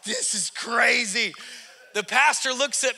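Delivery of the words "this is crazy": slow, stunned shout; shocked outcry. Retold or imitated. Visitor blurting his shock at the exorcism, a slow stunned shout.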